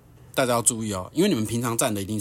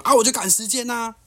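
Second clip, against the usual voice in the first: a much higher whiny mock grumble; annoyed excuse; 12 semitones higher, 5 dB louder than usual. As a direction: grumble the excuse like a sulky stranger. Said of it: "很不爽跟我说".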